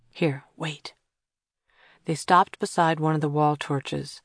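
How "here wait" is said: whispering. Acted. Sneaking past sleeping rooms.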